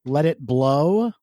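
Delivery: ordinary talk, nothing marked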